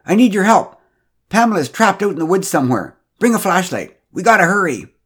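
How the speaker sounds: high, loud, urgent plea. A narrator's reading. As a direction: hurry them out the door. Urgent call for help.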